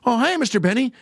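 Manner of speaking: put-on high, boyish tenor greeting. Grown man still playing the boy on radio, high and boyish. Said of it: "it was still the same thing".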